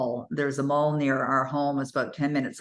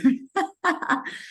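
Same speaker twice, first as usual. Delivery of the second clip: a high, delighted giggle; high laugh; 13 semitones higher than usual. Real, not acted. Telling the mall story, a high delighted giggle.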